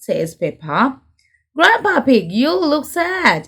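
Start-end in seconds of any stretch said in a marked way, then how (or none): 1.6-3.4 higher, cheery rabbit voice; Grampy Rabbit voice. Acted. Grampy Rabbit greeting sad Grandpa Pig, higher cheery voice. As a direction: call out to a sad friend. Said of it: "says Grampy Rabbit".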